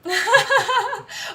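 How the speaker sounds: high, loud knowing laugh. Real, not acted. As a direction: laugh out at the irony. Laughs at Russians watching Miracle.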